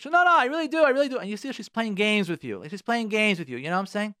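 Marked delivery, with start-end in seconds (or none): 0.1-1.2 high, loud mock protest in the wife's voice; much higher, louder imitation of an insistent wife. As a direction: squeal the denial like the wife insisting. Wife protests in acted quarrel.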